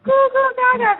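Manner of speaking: put-on high, loud baby babble; baby talk. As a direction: babble like an infant. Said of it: "Baby's like".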